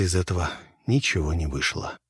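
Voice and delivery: plain reading voice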